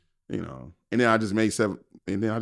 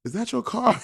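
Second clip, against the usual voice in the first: amazed question; 14 semitones higher than usual. Retold or imitated. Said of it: "I think we hollered".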